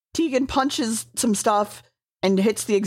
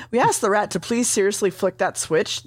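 usual speaking voice

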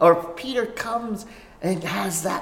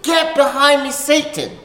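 louder, higher, commanding rebuke; Jesus sternly rebuking Peter; strong rebuke; 7 semitones higher, 5 dB louder than usual. Acted. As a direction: drive Peter off with full authority. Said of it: "one of the strongest rebukes in Scripture".

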